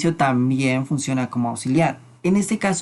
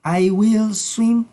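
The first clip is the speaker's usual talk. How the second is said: slow, higher "I will swim"; slow model sentence; 5 semitones higher, much slower than usual. Shown as a demonstration. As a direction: model it slowly for learners. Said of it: "queda como nadaré".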